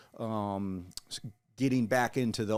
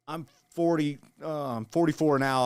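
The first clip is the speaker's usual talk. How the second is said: ordinary delivery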